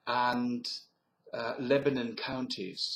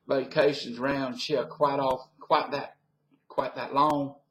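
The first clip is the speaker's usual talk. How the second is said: Southern US accent; 3 semitones higher than usual. Shown as a demonstration. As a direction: chat like a curious Virginia Southerner. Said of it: "so that's my southern accent".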